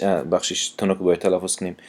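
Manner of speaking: ordinary talk, nothing marked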